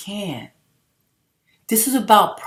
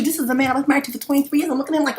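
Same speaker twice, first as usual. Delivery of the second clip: ordinary delivery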